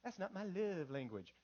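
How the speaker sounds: softly mimicking an excuse-making husband; mock cop-out. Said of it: "we use that as a cop-out".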